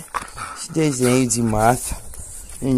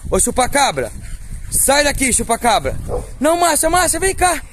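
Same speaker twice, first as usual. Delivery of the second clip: high raised call across the yard; 12 semitones higher than usual. Real, not acted. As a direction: shout the dogs away. Shouting at dogs.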